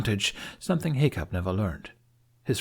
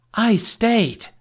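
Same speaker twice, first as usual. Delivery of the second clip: higher and louder, indignant; indignant outburst; 13 semitones higher, 7 dB louder than usual. A narrator's reading. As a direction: fire it back, proud and stung. Astrid defends herself, indignant.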